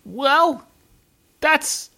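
high, sneering dismissal; haughty brush-off. Acted. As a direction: wave the stranger off with scorn. Adventurer answering Magnus.

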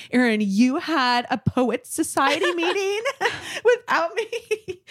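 laughing through a mock accusation; laughing complaint. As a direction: giggle out the teasing complaint. Retold teasing complaint to friend.

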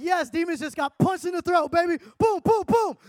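high, gloating celebration; gleeful cheering. Retold or imitated. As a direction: cheer each blow, pitch climbing high. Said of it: "So I'm laughing 'cause I'm like".

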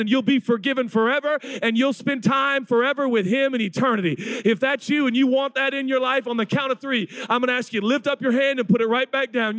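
high, driving preacher's invitation; altar call. Real, not acted. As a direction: press them urgently to respond, pitch held high. Urgent altar call to congregation.

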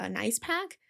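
ordinary talk

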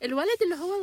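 high-pitched imitation of mom; mom impression. Daughter imitating mom picking up mid-story, high-pitched. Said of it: "She'll be like".

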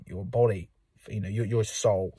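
ordinary talk, nothing marked

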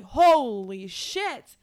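loud, slow "holy shit"; loud, drawn-out disbelief; stunned. Retold or imitated. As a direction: drag it out in shock. Retelling her shocked reaction.

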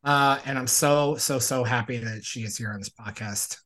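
loud, upbeat show-host delivery; podcast host intro. Acted. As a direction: pump up the welcome like a host. Podcast host opening the episode.

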